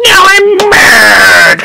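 slow, drawn-out angry threat; mock anger. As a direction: stretch out the play-acted rage. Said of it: "He told you he would get mad".

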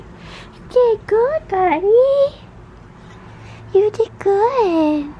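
high, slow cooing praise. Real, not acted. Praising cat in baby talk.

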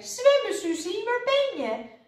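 high, loud searching call; high-pitched urgent cry; alarmed shout. A narrator's reading. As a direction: shout for your lost friend. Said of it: "riep Lodewijk".

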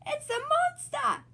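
high, slow frightened cry; little piglet yelling in mock fright; scared shout. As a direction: shriek out a mock monster alarm. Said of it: "says Peppa".

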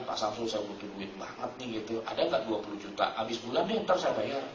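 pleading quietly to a close friend; hushed asking. Retold or imitated. Borrowing money from close friend.

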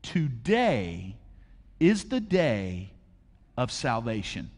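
slow, hushed solemn reading; scripture reading. Acted. Pastor quoting scripture, slow and solemn. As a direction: lay each word down gravely. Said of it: "It says it like this".

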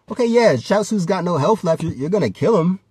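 normal talking voice

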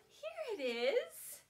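slow, happy here it is; delighted find. Pretend. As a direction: show the kids you found it. Pretend finding teapot.

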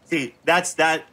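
normal talking voice

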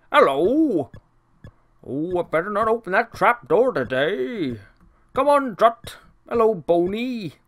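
lightly voiced cartoon servant, cheerful; a hint of the fussy servant Burke greeting his pets; imitating Burke. Burke greets his pets in the kitchen, lightly voiced cheerful servant. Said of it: "Burke actually did sound something a little like that".

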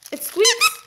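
thrilled squeak of discovery at the toy; high-pitched happy squeal; delighted surprise. Real, not acted.

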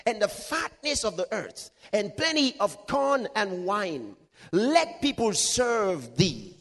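slow, high-pitched scripture declamation; proclaimed blessing. Acted. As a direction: draw out each phrase of the blessing. Continues declaiming Isaac's blessing.

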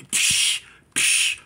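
sharp voiceless shattering noise; imitated breaking window. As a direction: mimic glass breaking. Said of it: "until one of the windows".

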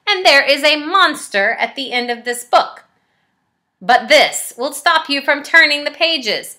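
bossy monster voice scolding the reader; frantic Grover. Acted. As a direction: Grover trying to stop the reader. Grover builds barriers, scolds.

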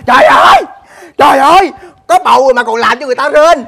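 high, loud cry of disbelief; outraged outburst. Acted. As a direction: explode in outrage at a friend. Nổi giận vì bạn bị tố ngoại tình. (la lối phẫn nộ)

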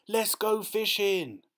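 put-on high, drawn-out boyish cheer; excited yell. A narrator's reading. Boy yelling from the window about fishing, excited high yell. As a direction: yell it out high and slowly, thrilled. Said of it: "runs to the window and yells".

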